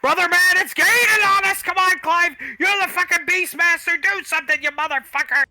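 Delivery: high, loud panicked yell at a partner. Acted. Shouting at Clive to fight the chasing liger, high and panicked. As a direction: scream for help as the beast gains. Driver screams as monster chases.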